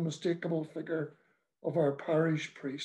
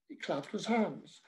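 usual speaking voice

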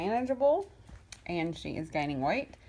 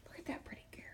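soft whisper to the kitten; whispering fondly over the sleeping kitten; 18 dB quieter, much faster than usual. Real, not acted. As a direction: hush it so you don't disturb her.

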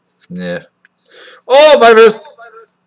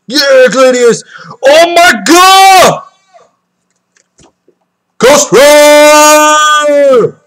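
slow, drawn-out high yell; ecstatic shout; 8 semitones higher, 4 dB louder, much slower than usual. Real, not acted. Opener pulls a rare card, drawn-out joyful yell. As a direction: stretch each word in disbelief. Big pull in card pack.

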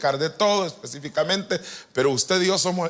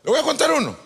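excited announcement; 11 semitones higher, 4 dB louder, faster than usual. Real, not acted. Repeats the announcement, pitched high.

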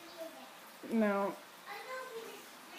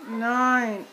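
normal talking voice

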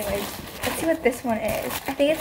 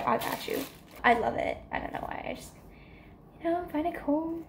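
soft delighted murmur; 4 semitones higher, 9 dB quieter, faster than usual. Real, not acted. Quiet delighted murmur over lamp.

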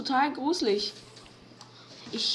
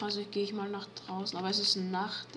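usual speaking voice

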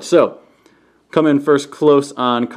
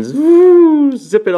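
high loud burst; excited zoom; 13 semitones higher, 5 dB louder, much slower than usual. Pretend. Reviewer zipping the toy car away, high loud burst. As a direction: launch into it like a race. Playful zoom sound start.

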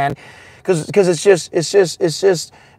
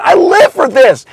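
normal talking voice

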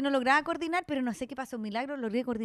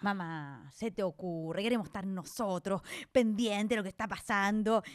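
lower, softer mock-proud reply; haughty refusal; 6 semitones lower, 4 dB quieter, slower than usual. Daughter refusing her mother's nurse, lower mock-proud brush-off. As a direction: brush off the offer.

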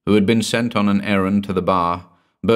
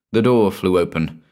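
ordinary delivery

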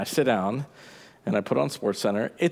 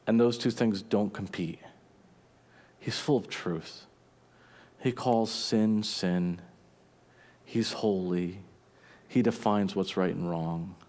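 softer, intimate preaching; earnest sermon close; 3 semitones lower, 4 dB quieter than usual. Acted. Preacher closing his sermon to the congregation, softer and earnest. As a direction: bring it home gently to the congregation.

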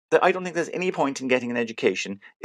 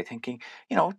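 ordinary delivery

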